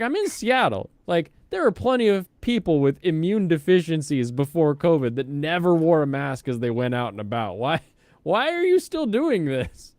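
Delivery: high, loud exasperated questioning; exasperated rant. Retold or imitated. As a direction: rant at the mask wearer. Ranting retold inner question.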